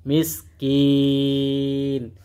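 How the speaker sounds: মিসকিন with the ee drawn out long; three-alif stretch. Shown as a demonstration. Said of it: "তিন আলিফ টান". (মিসকিন শব্দে তিন আলিফ টান)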